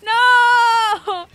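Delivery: loud high "no"; shout. Real, not acted. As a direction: yell out in frustration. Frustrated outburst at game.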